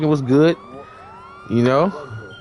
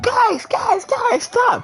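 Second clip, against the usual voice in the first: high, shouted pleas to stop; girlfriend pleading; much slower than usual. Retold or imitated. Retelling how the girlfriend begged the fighters to stop, high shout. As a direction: squeal at them to break it up.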